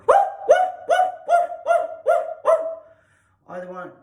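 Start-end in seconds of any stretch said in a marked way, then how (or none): 0.1-2.6 high, loud yappy woofs; much higher eager barks; excited dog barking. Shown as a demonstration. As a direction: bark with playful excitement. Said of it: "I'm excited for the game".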